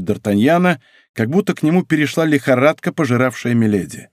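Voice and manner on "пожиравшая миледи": usual speaking voice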